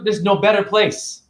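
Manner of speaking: ordinary delivery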